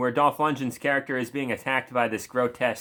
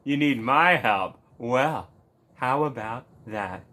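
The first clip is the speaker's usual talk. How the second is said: gloating villain toying with a victim; hammed-up sinister taunt, drawn out; mock sinister; 4 semitones higher than usual. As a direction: savor refusing to help, with mock menace. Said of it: "The very sinister look on his face".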